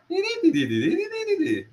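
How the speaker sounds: high sing-song "diddly-dee" babble; mimicked Irish lilt. Showing a colleague how Irish speech sounds to him, sung gibberish lilt. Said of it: "it's kind of like a".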